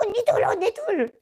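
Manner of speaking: high-pitched urgent retold call; excited calling. Youngest girl waking her cellmates, excited high call. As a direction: call out to wake them, pitch jumping.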